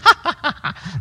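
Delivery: a high, chirpy imitated bird laugh; budgie laugh imitation. Preacher mimics the pet budgie's laugh. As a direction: laugh like the family budgie did. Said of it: "like that".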